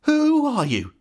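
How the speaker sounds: high, drawn-out spooked question; frightened old father's quaver. A narrator's reading. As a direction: ask fearfully, pitch leaping up. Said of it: "he asked in a quavering voice".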